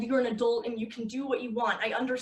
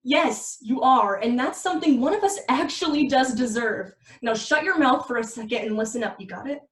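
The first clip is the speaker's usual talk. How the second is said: loud scolding; 7 dB louder than usual. Acted. Girlfriend scolds drunk boyfriend loudly.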